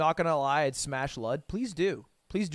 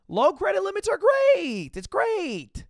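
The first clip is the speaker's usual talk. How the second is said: much higher-pitched insistence; emphatic enthusiasm; 11 semitones higher, 3 dB louder, slower than usual. Real, not acted. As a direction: insist in a high, raised voice. Insists jokingly that low limits are great.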